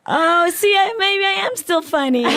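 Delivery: high, loud, giggly, pleased with herself; laughing delight. Real, not acted. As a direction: laugh through it, tickled by her own joke. Delighted at her own old joke.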